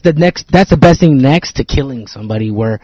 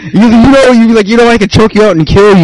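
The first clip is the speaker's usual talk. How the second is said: louder, higher threat; tough-guy threat; 8 semitones higher, 7 dB louder than usual. Acted. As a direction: play the menacing fighter. Friend voicing threat.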